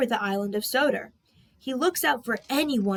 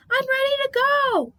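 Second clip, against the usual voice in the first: much higher, louder call; urgent shout; 5 dB louder than usual. Acted. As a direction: yell it after him. Percy shouting after Harold.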